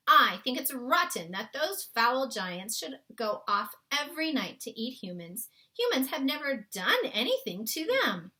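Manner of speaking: put-on a girl defending her own kind; Sophie's voice. A narrator's reading. Sophie's lines in story.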